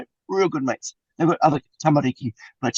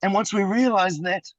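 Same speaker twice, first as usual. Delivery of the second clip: ordinary talk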